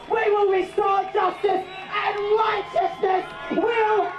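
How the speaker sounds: slow, high, shouted preaching; fiery rally shout. Real, not acted. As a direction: thunder it at the government like a preacher. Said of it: "you're a bit riled up out there".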